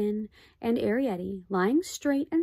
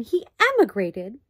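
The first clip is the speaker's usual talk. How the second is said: "emigrated" leaned on, louder; stress on emigrated; 6 dB louder than usual. A narrator's reading. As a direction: press hard on "emigrated". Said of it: "stressing the word".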